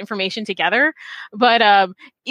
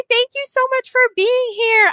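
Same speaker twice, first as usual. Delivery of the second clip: excited thanks; 13 semitones higher than usual. Real, not acted. Host greets new guest warmly.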